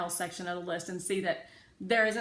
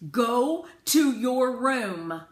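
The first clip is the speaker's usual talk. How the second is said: stern command; 6 semitones higher, 12 dB louder, much slower than usual. A narrator's reading. Father sends her away.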